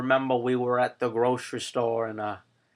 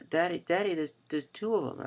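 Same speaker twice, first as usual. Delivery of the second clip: put-on higher, soft child's voice; young daughter; 5 semitones higher, 5 dB quieter, faster than usual. Daughter answering Daddy about his glasses, imitated child's voice. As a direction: imitate a puzzled little girl. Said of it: "I told my daughter".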